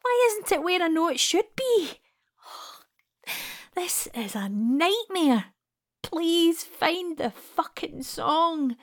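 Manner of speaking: panicked inner voice. Acted. Panicked inner thoughts searching records.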